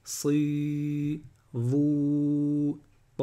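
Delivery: SI, VOUS, TA each stretched on a level tone; long vowels. Shown as a demonstration. Teacher drilling long vowels for learners, held long. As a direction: hold "si", "vous", "ta" long and steady. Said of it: "c'est une voyelle longue".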